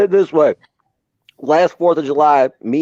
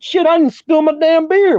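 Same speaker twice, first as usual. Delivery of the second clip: higher, gleeful mock exclaim; retold triumphant exclaim; 13 semitones higher than usual. Climbing out of the crash, crowing to everyone, higher and gleeful. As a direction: crow like you saved your beer.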